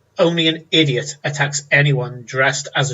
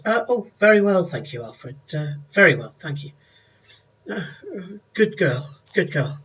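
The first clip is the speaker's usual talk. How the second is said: [name]'s voice; 4 semitones higher than usual. Acted. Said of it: "she goes".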